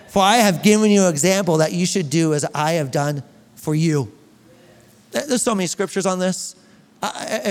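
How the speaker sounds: ordinary delivery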